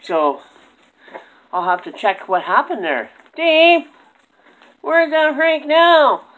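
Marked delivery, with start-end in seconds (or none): 3.3-6.2 high squeaky puppet call; squeaky ventriloquist dummy. Acted. Dummy calls Dave.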